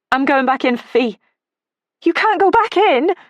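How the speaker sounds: loud, high frantic shouting; urgent shout. A narrator's reading. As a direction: shout over the fire. Arguing outside a burning house.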